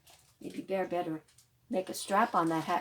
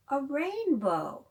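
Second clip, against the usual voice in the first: slow, louder "a rainbow" with a falling tune; excited reveal; 6 semitones higher, 7 dB louder, much slower than usual. Acted. Librarian showing children the rainbow toy, slow and louder. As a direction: unveil it like a treat.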